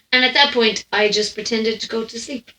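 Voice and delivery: ordinary talk, nothing marked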